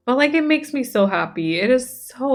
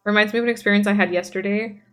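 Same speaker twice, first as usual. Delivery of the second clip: normal talking voice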